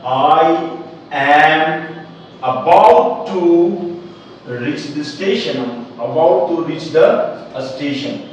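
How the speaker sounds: very slow, word by word, almost chanted; teacher chanting the answer slowly; slow sing-song model. Shown as a demonstration. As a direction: draw each word out for the class. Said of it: "क्या हो जाएगा?".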